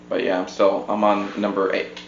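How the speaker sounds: ordinary talk, nothing marked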